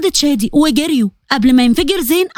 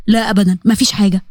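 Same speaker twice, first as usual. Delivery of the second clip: Fares deflecting; 6 semitones lower than usual. Acted. Fares hides his hurt from Sara, lower voice. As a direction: lower male voice, brushing it off.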